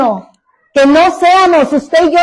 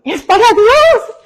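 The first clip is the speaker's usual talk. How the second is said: mock it higher and slower, with a laugh; mocking sing-song; 6 semitones higher, slower than usual. Preacher mocking a careless musician's excuse, mock sing-song. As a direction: drawl it out slowly as a hammed-up imitation. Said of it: "lo hemos escuchado".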